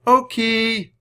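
high, long, loud name call. A narrator's reading. Children keep searching among the trees, calling. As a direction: holler for the missing puppy. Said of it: "they called".

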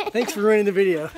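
laughing through the words. Real, not acted. Laughing while scolding daughter.

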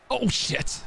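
high, startled curse; alarmed. Real, not acted. Gamer caught out mid-play, startled high outburst. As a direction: blurt it out in real alarm.

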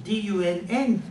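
ordinary talk

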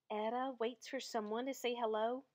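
ordinary delivery, nothing marked

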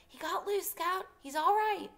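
put-on high, relieved boy's cry; cried out. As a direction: call out the good news. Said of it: "until Jem cried".

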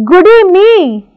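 high, loud cry of delight; excited cry. A narrator's reading. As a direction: cry it out, thrilled. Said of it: "cried the old hag".

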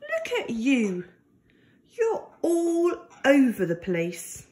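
usual speaking voice

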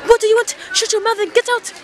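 very high, loud mock fury; rude shopkeeper. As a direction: imitate a shopkeeper yelling at a customer. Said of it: "has been super rude".

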